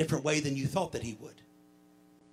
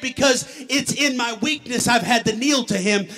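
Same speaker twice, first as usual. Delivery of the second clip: driving sermon delivery, louder and higher than the opening; impassioned preaching; 4 semitones higher, 6 dB louder than usual. Acted. Preacher testifying to the church about strength to stand, impassioned. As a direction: preach it in an unbroken, building run.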